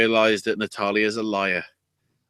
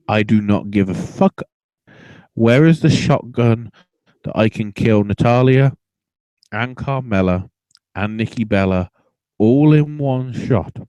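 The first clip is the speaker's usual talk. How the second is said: louder, fed-up mock threat; exasperated mock rant; 4 dB louder than usual. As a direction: rant as if done with everyone.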